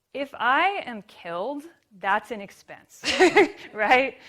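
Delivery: laughing. Real, not acted. Laughing at the absurd claim.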